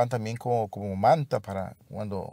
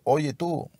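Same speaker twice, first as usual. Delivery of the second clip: higher-pitched hail to a stranger; mock rude call-out; 6 semitones higher than usual. Preacher mimicking how the blind man didn't shout, mock rude call-out. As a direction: call out as if hailing someone rudely. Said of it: "No le gritó".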